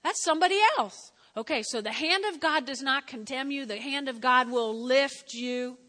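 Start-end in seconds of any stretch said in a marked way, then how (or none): 0.0-1.1 firm correction. Retold or imitated. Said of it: "And I was like".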